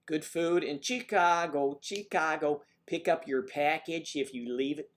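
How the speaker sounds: Midwestern voice. Shown as a demonstration. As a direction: lay the Midwestern accent on thick. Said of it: "Midwestern voice".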